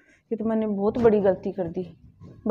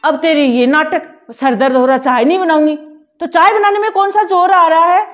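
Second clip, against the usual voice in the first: scolding; 6 dB louder than usual. Acted. Mother-in-law berates elder daughter-in-law over tea, angry and loud. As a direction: dismiss her headache with an angry scold.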